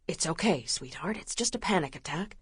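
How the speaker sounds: calm her panic tenderly; gentle reassurance. A narrator's reading. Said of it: "His surprisingly gentle touch".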